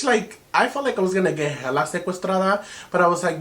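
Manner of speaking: normal talking voice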